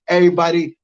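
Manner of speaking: ordinary delivery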